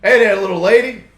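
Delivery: a hint of a smooth-talking Texan big shot; Texan producer voice. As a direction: lay on the charm, cowboy-style.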